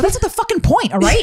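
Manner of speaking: high, loud mock snap; high exasperated retort. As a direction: put them in their place, high and loud. Snapping at midwives.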